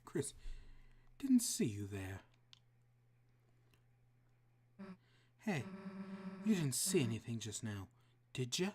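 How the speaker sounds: put-on tough girl Susie. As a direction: loom over Chris, threatening. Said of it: "God, no, that doesn't work".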